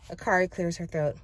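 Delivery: ordinary delivery, nothing marked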